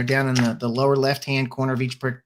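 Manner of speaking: ordinary delivery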